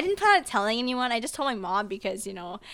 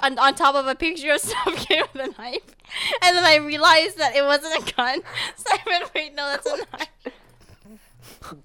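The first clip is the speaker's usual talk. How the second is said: higher-pitched, breaking up with laughter; helpless laughter; 5 semitones higher, 4 dB louder than usual. Real, not acted. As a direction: try to tell it while cracking up. Cracking up over own mistake.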